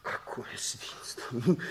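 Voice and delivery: hissed under the breath, slow; disgusted whisper. Acted. Furious man whispers his disgust at the situation. Angry character mutters in disgust.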